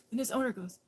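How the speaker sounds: ordinary talk, nothing marked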